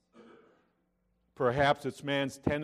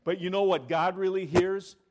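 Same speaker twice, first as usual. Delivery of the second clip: ordinary talk, nothing marked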